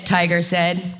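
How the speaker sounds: usual speaking voice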